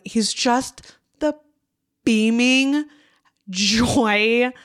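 normal talking voice

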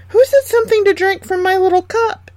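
very high, loud little dwarf voice; a tiny dwarf fussing over his cup; squeaky dwarf. A narrator's reading. Seventh dwarf checks his cup, very high loud squeaky voice. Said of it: "said the seventh".

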